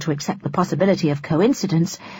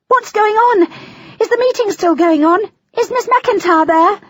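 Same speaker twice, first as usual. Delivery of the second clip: high, loud panicked questions; 5 dB louder than usual. Acted. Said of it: "Her panic".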